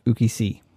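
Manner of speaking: ukisi stressed on SI; final-syllable stress. Shown as a demonstration. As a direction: put the accent on the end of ukisi. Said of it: "have the accent on the ultima".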